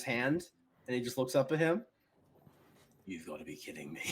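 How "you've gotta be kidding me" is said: very low, quiet disbelief; unimpressed knight. Acted. Sir Rory looking up after a hit, low quiet disbelief. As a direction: say it under your breath, menacingly unimpressed.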